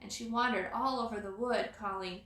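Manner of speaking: ordinary delivery, nothing marked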